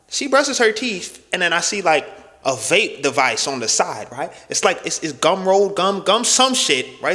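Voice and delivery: ordinary delivery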